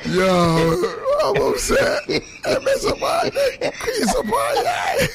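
high squeal of laughter; laughing. Real, not acted. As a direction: crack up laughing, voice jumping way up.

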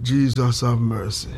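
slow, low plea for mercy; flustered plea. Real, not acted. Flustered at his own slip, a slow low plea. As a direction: draw it out low, in real dismay.

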